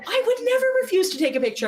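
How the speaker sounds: high, fast protest; mock-indignant denial. [name] denying he refused a picture, mock indignation.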